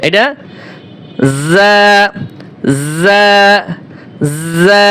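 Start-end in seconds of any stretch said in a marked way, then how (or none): 1.5-4.9 জা with a firm buzzing z, repeated apart; spaced, thin but firm জা; firmer za. Shown as a demonstration. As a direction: press a little on the z of each জা. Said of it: "জর থেকে শক্ত হবে".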